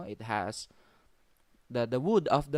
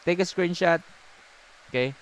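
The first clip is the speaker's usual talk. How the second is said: higher, louder live seller call; 8 semitones higher, 10 dB louder than usual. Acted. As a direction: hype it like an online sale. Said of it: "Parang live selling eh".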